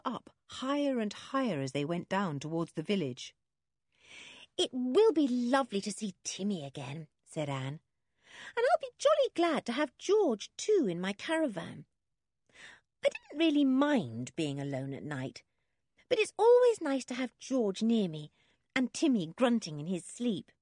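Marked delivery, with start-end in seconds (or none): 4.6-7.1 put-on high, light storybook girl; happily anticipating, pitched up; eager young girl. A narrator's reading. 8.6-20.5 put-on girl's voice starting high, easing down; lightly voiced girl, glad of company; eager young girl. A narrator's reading.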